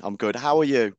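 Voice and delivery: ordinary talk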